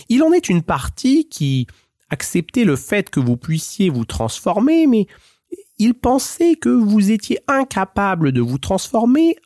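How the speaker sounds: a sly, flattering little cat; Puss in Boots. Acted.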